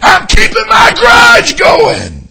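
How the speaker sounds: loud, high, slow mock reply; acted grudge keeper. Preacher acting out a person coddling a grudge, loud and high. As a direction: ham up the stubborn grudge keeper.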